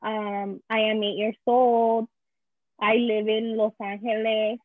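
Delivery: put-on reciting newcomer English with a strong Spanish accent; simple classroom English in a thick Spanish accent; beginner's Spanish-accented English. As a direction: imitate a little kid's memorized introduction, Spanish-accented. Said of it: "so I go up and I say that".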